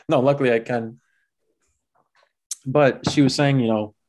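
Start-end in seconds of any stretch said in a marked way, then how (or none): none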